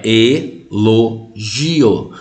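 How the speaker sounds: elogio stretched slowly, e-lo-gi-o, each syllable separate; syllables pulled apart. Shown as a demonstration. Teacher to class, slowly splitting elogio into syllables. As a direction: spell out elogio syllable by syllable, very slow. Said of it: "você separa".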